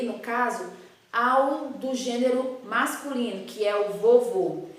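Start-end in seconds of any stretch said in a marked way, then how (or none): 3.9-4.7 VOVÔ with closed "oh" vowels. Shown as a demonstration. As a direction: model "vovô" with rounded closed O. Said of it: "o som da palavra vovô é mais fechado".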